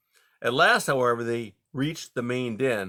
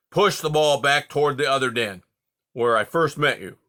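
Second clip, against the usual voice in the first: firm, louder orders; commanding; 3 semitones higher, 6 dB louder than usual. Acted. Porcupine tells foxes to push the ball, commanding. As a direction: direct them like a leader. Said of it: "Eric commanded".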